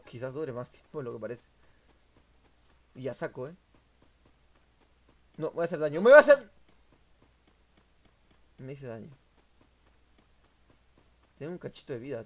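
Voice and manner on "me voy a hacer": sudden high, very loud yelp; panicked shout. Real, not acted. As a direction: cry out as damage is coming. About to take damage.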